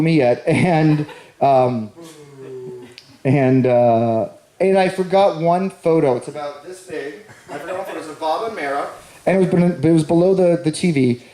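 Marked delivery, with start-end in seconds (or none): 6.2-8.9 hushed aside. Real, not acted. Quiet aside about the photo.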